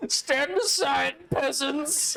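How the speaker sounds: high, haughty aristocrat proclamation; stagey fake archaic declaiming. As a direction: lord it over the peasants. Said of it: "The top hat and the monocle".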